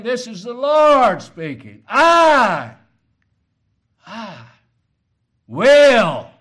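preacher roaring each word apart; full-force preaching. Shown as a demonstration. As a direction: thunder it out as the Lord's own word. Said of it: "he emphasized each word".

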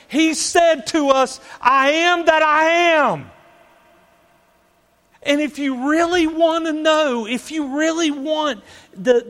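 high, loud, impassioned proclamation; preaching at full force. Acted. Preacher proclaims God's name to congregation, preaching fervently. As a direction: drive it home to the congregation at full voice.

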